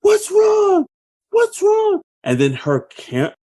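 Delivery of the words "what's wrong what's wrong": high-pitched imitation of her frantic yell; mock distressed yelling. Film heroine yelling at the man standing there, mock distressed yelling. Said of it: "she's just yelling at him".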